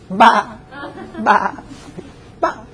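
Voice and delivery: laughing mock baa; giggly sheep bleating. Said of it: "As a sheep".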